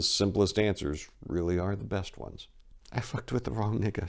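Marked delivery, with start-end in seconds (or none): 2.9-4.1 softly, head down, beaten; quiet admission. Acted. Maria admitting her mistake, quietly. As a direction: confess it under her breath. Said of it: "she quietly said".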